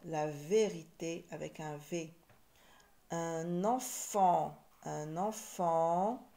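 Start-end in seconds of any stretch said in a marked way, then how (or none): 3.1-6.3 "enfant" very slow and stretched; slow teacher's sounding-out of "enfant"; syllable by syllable. Shown as a demonstration. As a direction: hold both nasal vowels of "enfant".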